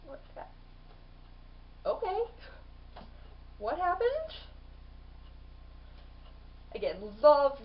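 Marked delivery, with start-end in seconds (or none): 0.1-1.9 breathy stunned "what the"; a dumbfounded hush, cut off mid-curse; stunned whisper. Retold or imitated. Gillian stunned to find the attackers beaten, whispering. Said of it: "and he's like". 1.9-4.3 higher, confused "okay, what happened"; baffled. Retold or imitated. Gillian arriving too late, asking baffled. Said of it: "and he's like".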